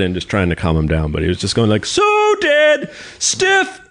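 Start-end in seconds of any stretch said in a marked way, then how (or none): 1.9-3.8 high, held imitated holler; screaming deaf neighbor. Host as deaf neighbor who won't calm down, high imitated scream. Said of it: "he just screams from all that tractor work".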